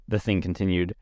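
ordinary delivery, nothing marked

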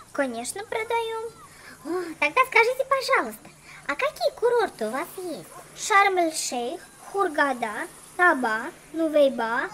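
ordinary talk, nothing marked

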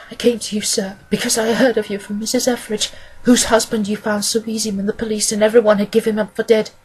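earnest woman client, pleading. Acted. Woman begging the detective to find Mister Hosmer Angel, earnest and pleading. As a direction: appeal humbly for the detective's help.

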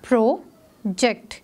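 slow "प्रोजेक्ट" as pro, pause, ject; split into syllables. Shown as a demonstration. Teacher showing two divisions to pupils, syllable by syllable. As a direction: break "प्रोजेक्ट" into two separate beats. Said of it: "टू syllabic division हैं".

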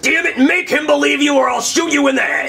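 furious yelling threat; angry shout. Acted. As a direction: yell the threat in fury. Kidnapper threatens to shoot.

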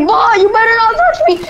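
high squeaky cat voice. Retold or imitated. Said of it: "and it's like".

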